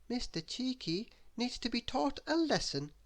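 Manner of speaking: high-pitched prim story-character voice; high, measured little voice; Little Miss Neat. A narrator's reading. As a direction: voice a small character, pitched well up. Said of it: "said Little Miss Neat".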